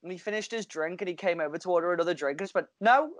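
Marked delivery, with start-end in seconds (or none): 2.8-3.1 higher, louder retold no; a touch of mock defiance; firm mock refusal. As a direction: shut him down flatly. Bartender refusing service.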